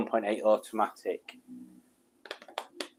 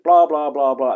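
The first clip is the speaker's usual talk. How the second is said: imitating Matt's chatter; 2 semitones higher, 8 dB louder than usual. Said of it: "Matt seems to be like".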